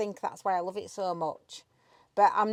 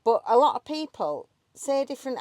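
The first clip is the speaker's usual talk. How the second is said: ordinary delivery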